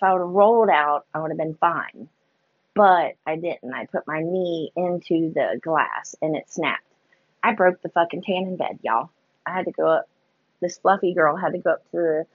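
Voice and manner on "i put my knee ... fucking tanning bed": ordinary delivery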